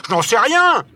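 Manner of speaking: high, loud, exasperated retort; stamping anger. A narrator's reading. Barbicane stamps his foot at Nicole, angry. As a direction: fire it back with a stamp. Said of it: "en frappant du pied".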